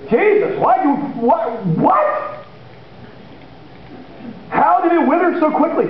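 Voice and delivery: high, astonished questions; amazed disciples. Retold or imitated. Disciples amazed at the withered fig tree, high and astonished. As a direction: ask in wonder how it happened. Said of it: "disciples asking".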